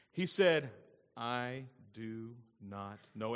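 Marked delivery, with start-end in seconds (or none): 1.2-3.4 low, soft and slow; measured denial. Acted. Cain answers God about his brother, measured denial. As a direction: draw out the denial word by word.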